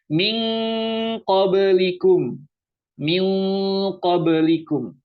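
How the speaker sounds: "Min" held as "ming" before slow "qablikum"; ng reading. Shown as a demonstration. Teacher repeating correct reading, "Min" as long "ming". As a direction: chant "Min qablikum", lingering on NG. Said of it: "Bacanya itu ming".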